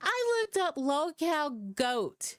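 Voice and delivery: high-pitched, on the edge of laughter; amused disbelief. Real, not acted. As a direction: blurt it out, tickled by your own mistake. Cracking up at own typo.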